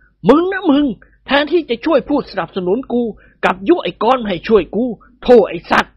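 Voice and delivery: high, scolding outburst at a servant; angry old master. A narrator's reading. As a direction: berate him, furious but comic. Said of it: "ท่านเจ้าคุณตะโกนลั่น". (เจ้าคุณด่าไอ้แห้วอย่างโกรธจัด)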